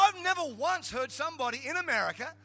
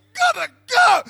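very high, very loud outburst; hammed-up high-pitched holler; panicked mock shout; 13 semitones higher, 18 dB louder than usual. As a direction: yelp it out like you're bolting from the room. Acts offended attorney fleeing court.

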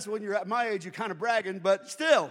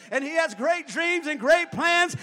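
loud, rising shouted preaching; full-voiced revival preaching; preaching at full force; 5 semitones higher, 9 dB louder than usual. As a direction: build the crowd up, louder and louder.

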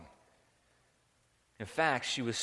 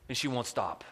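usual speaking voice

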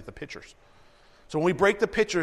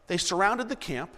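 normal talking voice

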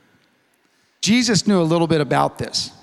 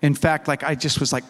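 normal talking voice

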